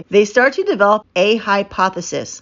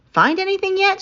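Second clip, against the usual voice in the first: put-on a higher, light girl's voice for Mika; lightly voiced Mika; 12 semitones higher than usual. A narrator's reading. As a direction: lift the voice a little for Mika.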